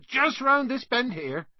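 high, projected call to followers; calling over shoulder. A narrator's reading. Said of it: "Hagrid called over his shoulder".

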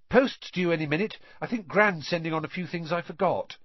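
put-on high-pitched, chirpy boy's greeting; cheerful. A narrator's reading. Forgetful boy awaits a parcel from Gran, cheerful. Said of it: "greeted them cheerfully".

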